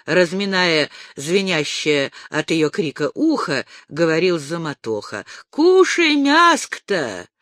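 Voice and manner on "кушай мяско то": loud, high and slow; shouting. A narrator's reading. The deaf ataman shouts at Dasha to eat her meat, loud. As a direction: boom the offer of food across the table. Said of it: "прокричал снова".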